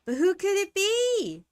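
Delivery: high, loud eager wonder; bright excited guess about the knock. Acted. Player at the door, high excited wondering aloud.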